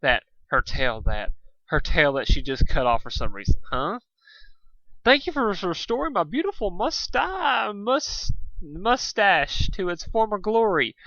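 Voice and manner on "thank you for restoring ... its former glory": camp it up as the vain, weepy sea serpent; fabulous sea serpent. Acted. The vain sea serpent wailing over his lost moustache, high and theatrical.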